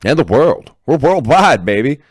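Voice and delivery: high, loud hype shout. Real, not acted. Hyping the podcast's worldwide reach, loud and high. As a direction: yell it out like a victory lap.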